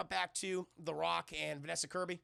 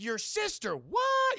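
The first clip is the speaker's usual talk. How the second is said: builds from raised query to shrieked question; mock astonishment; 8 dB louder, much slower than usual. Agent learns of brother, question rising to astonished shout. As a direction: explode into high, loud disbelief. The Rock astonished at sibling news.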